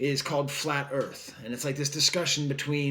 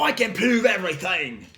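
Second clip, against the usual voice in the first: loud, high Cockney rant; Cockney bloke insisting at high volume; bad Cockney accent; 5 semitones higher, 6 dB louder than usual. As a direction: put on a hammy Cockney accent. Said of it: "a Cockney accent".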